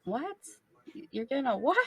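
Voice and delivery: high, rising baffled questions; puzzled disbelief. Retold or imitated. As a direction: question it as if you misheard. Acting out puzzlement at odd pronunciation.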